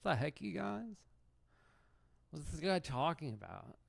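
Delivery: high-pitched disbelieving chess streamer; Hikaru impression. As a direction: squeal it out like an outraged rival player. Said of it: "I believe I'm supposed to be Hikaru".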